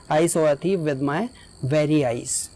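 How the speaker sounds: very slow, every word weighted, "thief" prominent; emphatic stress. Shown as a demonstration. Teacher giving the emphatic example, slow and weighted. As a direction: spell it out slowly with weight on "very". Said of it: "own की जगह पर हम very भी लगा सकते हैं".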